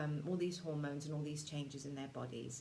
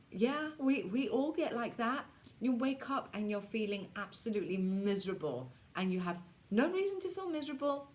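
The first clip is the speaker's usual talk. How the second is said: usual speaking voice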